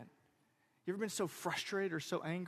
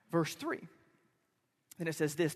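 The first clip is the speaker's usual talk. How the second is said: ordinary delivery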